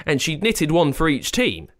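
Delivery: usual speaking voice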